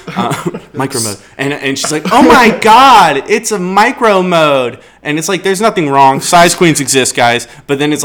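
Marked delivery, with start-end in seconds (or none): 2.1-4.8 higher, slow mock gasp of a shocked girl. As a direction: ham up her dismay, drawn out. Girl voiced in story.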